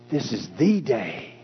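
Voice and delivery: THE stretched to "thee" and lifted; stress on THE. Shown as a demonstration. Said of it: "When you break it up and say".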